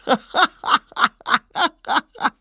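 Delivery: high-pitched mocking laughter; loud laughing. A narrator's reading. Whole market crowd laughs at father and son, loud laughing. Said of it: "Alle lo højt".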